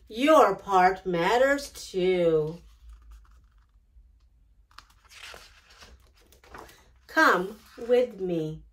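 tender closing invitation. A narrator's reading. Closing message to child listeners.

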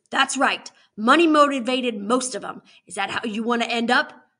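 loud, emphatic lecture; angry warden's warning; stern scolding. Acted. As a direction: drive the lesson home hard.

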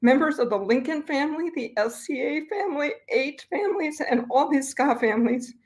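holding back tears while thanking people; getting emotional. Real, not acted. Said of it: "I'm getting a little emotional".

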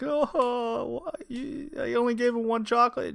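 high, weepy imitation of distress; mock wailing. Mother surrounded by rabbits, mock tearful wail. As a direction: whimper it high, hamming up the despair.

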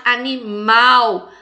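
"animal" slowed, with weight on final "mal"; stressed last syllable. Shown as a demonstration. Teacher giving the singular example, "mal" stressed in "animal". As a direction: lean on a long "mal" in "animal". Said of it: "A última sílaba é a sílaba tônica".

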